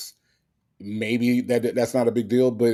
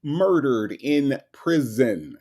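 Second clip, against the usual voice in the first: slow emphasis; 6 semitones higher, slower than usual. Real, not acted. Slow emphatic argument point.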